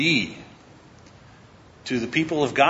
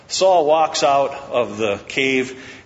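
ordinary talk, nothing marked

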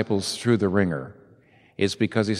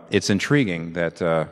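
usual speaking voice

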